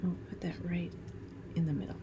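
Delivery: whispered aside. Real, not acted. Crafter placing a piece, hushed whisper. Quiet aside while working.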